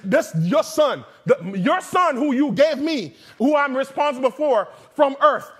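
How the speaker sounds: rising to a furious yell at God; hammed-up furious prayer to God, climbing to a scream; mock angry prayer. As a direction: pray for vengeance, building to a shout. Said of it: "that's how mad I was".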